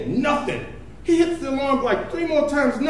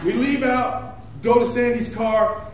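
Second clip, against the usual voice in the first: ordinary talk, nothing marked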